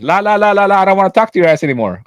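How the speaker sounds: quick, high la-la refusal; reenacted stubborn not-listening; childish drowning-out. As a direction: chant to shut the customer out. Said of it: "like I said".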